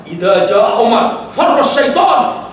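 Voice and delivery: slow, loud, chanted hadith; solemn recitation of the Prophet's words; Arabic recitation. Acted. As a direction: intone the Arabic slowly and loudly.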